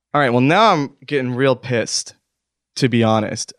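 ordinary talk, nothing marked

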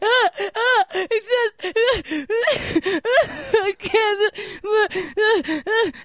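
high, hysterical fake sobbing; over-the-top put-on breakdown; going nuts. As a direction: wail and gasp as if unable to get words out. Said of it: "started going nuts with it".

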